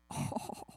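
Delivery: soft, voiceless gasp of wonder; awed gasp. Acted. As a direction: gasp as if spotting treasure. Acted awe at candy bin.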